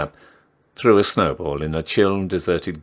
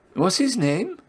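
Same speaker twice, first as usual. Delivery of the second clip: chatty barmaid; 14 semitones higher than usual. Acted. Barmaid asks.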